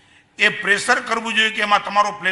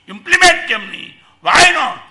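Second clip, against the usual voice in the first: higher, louder rhetorical demand; challenging; 5 semitones higher, 6 dB louder than usual. Real, not acted. Preacher presses his listeners, loud and challenging. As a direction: throw the question hard at the crowd.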